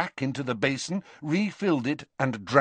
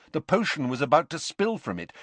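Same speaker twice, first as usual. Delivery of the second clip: ordinary delivery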